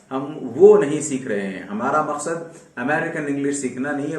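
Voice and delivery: normal talking voice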